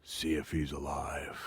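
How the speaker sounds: low, slow, offhand instruction; casual. Retold or imitated. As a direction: say it casually under your breath, imitating a colleague. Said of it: "It was said so casually".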